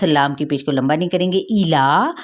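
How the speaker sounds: normal talking voice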